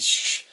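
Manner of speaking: long, forceful shh; loud airy shush. Acted. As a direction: hush them hard. Character repeats the shush.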